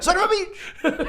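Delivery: high, laughing villain curse; mock curse. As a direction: squeal it as you fall. Player voices villain falling.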